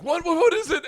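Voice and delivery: high, loud, repeated questioning; hammed-up frantic question; mock panic. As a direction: re-enact alarm at what is coming. Alarmed on the floor, retold.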